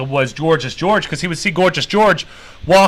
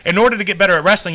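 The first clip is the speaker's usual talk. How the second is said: usual speaking voice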